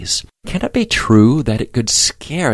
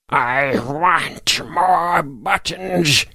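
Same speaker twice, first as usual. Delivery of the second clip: put-on high, very slow, hoarse old voice; hoarse shouting voice; 6 semitones higher, 4 dB quieter, much slower than usual. A narrator's reading. Hidden voice demands more through the barn door, eerie drawn-out mock shout. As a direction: stretch each word out in an eerie high croak. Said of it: "he shouted through the door".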